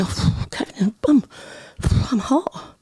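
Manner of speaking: hammed-up breathy whisper, high on hot; mock sultry. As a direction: play coy and suggestive as a joke. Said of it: "and I say".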